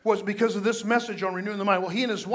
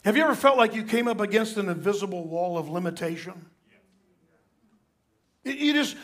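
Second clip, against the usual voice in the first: usual speaking voice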